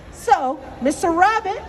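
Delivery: plain reading voice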